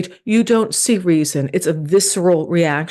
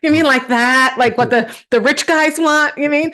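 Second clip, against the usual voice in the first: mock innocence; 7 semitones higher than usual. Mock-naive teasing of producer.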